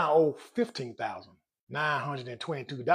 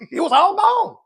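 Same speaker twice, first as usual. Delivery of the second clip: very high, loud mock wail; comic outcry; 10 dB louder than usual. Man laughing about losing all his money, comic high wail. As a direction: squeal the punchline in mock despair. Punchline of lost savings, after laughing.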